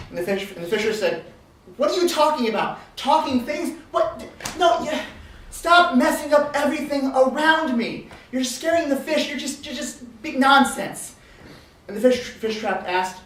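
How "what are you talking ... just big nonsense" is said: higher, louder, spluttering rebuke; exasperated scolding. Acted. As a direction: tell them off in disbelief. Fisher scolds the two runners.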